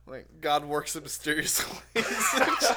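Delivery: slow words broken by laughter; laughing. Real, not acted. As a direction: force it out between laughs. Laughing at the dog story.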